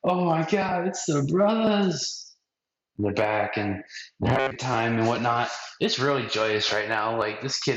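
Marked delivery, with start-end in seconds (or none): 0.0-2.2 high, slowed, thrilled; excited bros. Retold or imitated. Pirate hunters greeting Zoro, thrilled and high. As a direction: gush over the brothers. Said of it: "They're like".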